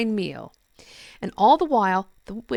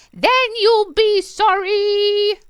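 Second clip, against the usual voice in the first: high, slow witch threat; 9 dB louder, much slower than usual. Acted. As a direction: drag it out like a witch. Witch voice again.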